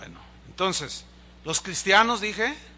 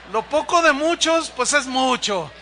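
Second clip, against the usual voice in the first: playful punchline; 6 semitones higher, 8 dB louder than usual. Real, not acted. Joking about congregation paying bail.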